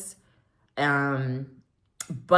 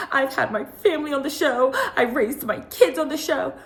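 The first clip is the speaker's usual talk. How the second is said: high, mocking Kyle voice; imitating a reality star's self-defense; quoting Kyle; 9 semitones higher than usual. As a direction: mimic her smug reunion line. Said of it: "she said in the last reunion".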